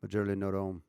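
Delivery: "Majorly no dome" run together for "usually not home"; relaxed-jaw Aussie pronunciation. Shown as a demonstration. Streamer practises Aussie phrase, "Majorly no dome" with loose jaw. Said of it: "I'm trying to relax the jaw".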